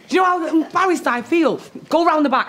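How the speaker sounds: embarrassed, exasperated reprimand; cross scolding. Acted. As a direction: send the culprit away crossly. Adult scolding Marley in the shop.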